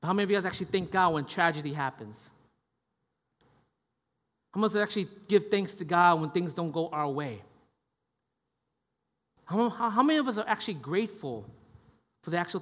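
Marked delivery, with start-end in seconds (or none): none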